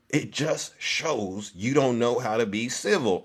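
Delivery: ordinary talk, nothing marked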